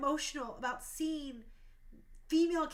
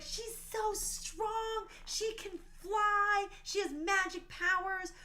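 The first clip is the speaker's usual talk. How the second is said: put-on higher, slow, wide-eyed kid wonder; kids in awe; 6 semitones higher, slower than usual. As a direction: imitate kids gushing over a hero, slowed right down. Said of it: "they're like".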